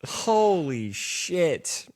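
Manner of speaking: slow, drawn-out amazement; real floored disbelief; stunned. Real, not acted. Shocked reaction to pillow talk.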